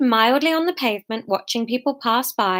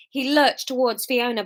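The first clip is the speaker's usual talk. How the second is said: usual speaking voice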